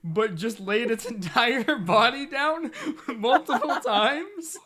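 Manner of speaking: laughing through the words, high and loud; cracking up mid-sentence. Real, not acted. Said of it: "the way I said it".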